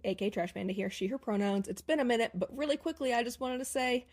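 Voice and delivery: ordinary talk, nothing marked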